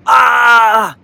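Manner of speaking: high, loud "ah" yell; pained scream. A narrator's reading. Boy falls and bangs his knee, screaming. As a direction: cry out sharply in pain, high and loud. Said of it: "he yells out this scream".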